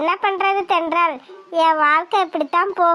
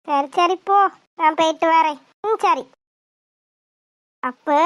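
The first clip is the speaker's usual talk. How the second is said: ordinary delivery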